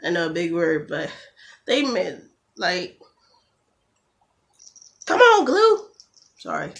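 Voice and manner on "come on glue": high, loud frustrated yell at the glue; annoyed snap. Real, not acted. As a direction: lose patience with the bottle. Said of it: "I get mad easily".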